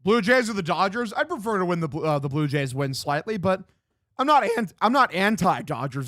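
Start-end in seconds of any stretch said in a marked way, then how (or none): 4.2-4.7 voice crack. Real, not acted. Streamer answering chat about the Dodgers, accidental voice crack. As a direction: voice cracks high mid-word. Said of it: "That was a bad fucking voice crack".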